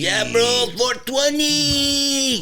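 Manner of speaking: drawn-out mock Mexican accent, high and slow; goofy put-on Mexican accent. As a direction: stretch out four twenty in a jokey Mexican accent. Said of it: "I did that in a Mexican accent".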